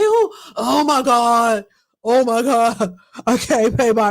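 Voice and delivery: lightly put-on man's voice; mock a man crying for help, half-hearted; attempted male voice. Woman imitating a man pleading on social media, attempted male voice. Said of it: "I'm trying to do my male voice".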